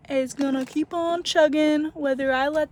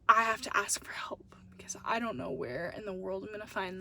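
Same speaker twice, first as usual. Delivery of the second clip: whispered thought; 6 semitones lower, 9 dB quieter than usual. Retold or imitated. Retold inner thought, nervous.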